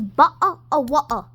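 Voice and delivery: mock British. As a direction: say "butter" with a dropped r, British style. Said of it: "in England says".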